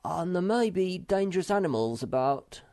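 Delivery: nervous warning. A narrator's reading. Moomin Troll fears dangerous animals.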